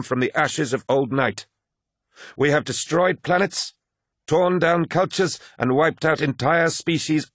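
proud, high-pitched rallying oratory; ringing, emphatic speech to troops; commanding lodge leader. Acted. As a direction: rouse the warriors to cheers. Voices Targost's rousing speech.

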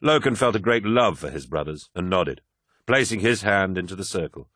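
plain reading voice

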